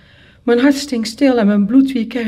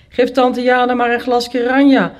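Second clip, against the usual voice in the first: raised, louder teasing jibe; teasing uncles; 6 semitones higher, 5 dB louder than usual. A narrator's reading. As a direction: tease her at a birthday party. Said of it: "plaagde mijn ooms haar".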